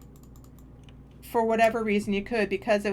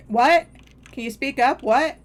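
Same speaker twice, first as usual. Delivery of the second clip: raised voice with sharp rising questions; retold loud demand; 5 semitones higher, 8 dB louder than usual. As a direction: call out higher and louder, asking them to speak up.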